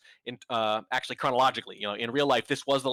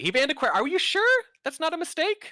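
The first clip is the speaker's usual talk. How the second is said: mock disbelief, pitched way up; sarcastic; 4 dB louder than usual. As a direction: act shocked, dripping sarcasm. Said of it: "I am being unbelievably sarcastic".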